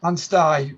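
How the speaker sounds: Anstey ending to rhyme with "sky"; local pronunciation of Anstey. Shown as a demonstration. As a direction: echo Anstey with a final "eye". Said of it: "get into the local vernacular".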